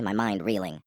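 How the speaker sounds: ordinary delivery, nothing marked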